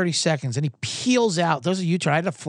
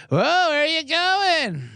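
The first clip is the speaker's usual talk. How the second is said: put-on much higher, slow, drawn-out old man asking; a nosy old driver making small talk; chatty grandpa; 12 semitones higher, much slower than usual. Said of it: "old grandpa guy".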